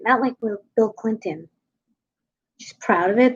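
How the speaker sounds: normal talking voice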